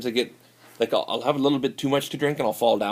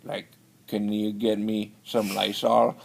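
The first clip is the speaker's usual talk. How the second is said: slowed-down imitation of a stranger begging; slow mock drawl of the other man; slower than usual. [name] mimicking the man asking for Lysol in a slow drawl. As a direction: drag it out like a slurring stranger. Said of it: "he was like".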